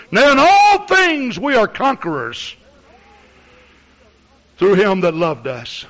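"conquerors" run straight on after "we are"; sweeping through "we are conquerors" in one loud breath; full-force preaching. Shown as a demonstration. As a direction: proclaim it loud and high, "all" punched, easing off by "loved us". Misquotes verse, then corrects it.

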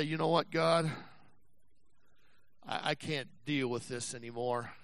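soft, low, worn-out surrender to God; weary quiet prayer. Acted. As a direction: give up quietly, tired. Preacher voices a weary prayer.

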